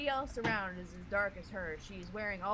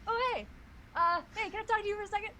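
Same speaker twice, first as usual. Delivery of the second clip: high, loud calling-out critter; squirrel character voice; 13 semitones higher, 7 dB louder, faster than usual. Acted. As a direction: shout up at the chipmunk, high. Squirrel greets chipmunk loudly.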